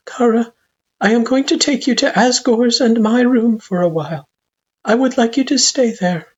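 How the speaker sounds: very high, measured mother-figure voice; parent figure. A narrator's reading. Parent settles child in another room, gently instructing. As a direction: instruct gently, pitched well up.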